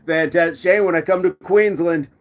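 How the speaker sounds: usual speaking voice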